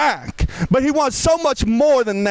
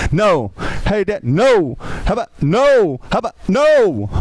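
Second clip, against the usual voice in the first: put-on sassy kid refusal; 2 semitones lower than usual. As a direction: throw a defiant no at dad. Kid's sassy refusal to dad.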